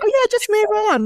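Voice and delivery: high sing-song mocking of careless advice; mock dismissive quote. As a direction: sneer it back high and fast.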